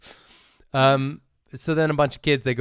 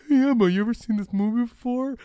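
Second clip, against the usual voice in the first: imitating himself; 12 semitones higher, 3 dB louder than usual. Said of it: "what we do on this podcast is I go".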